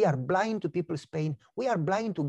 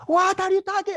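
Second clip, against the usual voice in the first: high, loud, offended cry; indignant outburst; 14 semitones higher, 7 dB louder than usual. Retold or imitated. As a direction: snap back, shocked and insulted. Divorcing lady bursts out offended.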